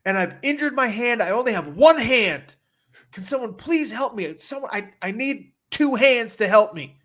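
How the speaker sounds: high-pitched mock complaint; aggrieved intercom announcement. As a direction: imitate a clerk airing grievances over the intercom. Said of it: "airing her grievance".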